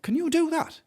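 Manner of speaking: high-pitched astonished question; imitated surprise. Friend amazed you change your own brakes, imitated surprise. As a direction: voice leaping up in mock amazement.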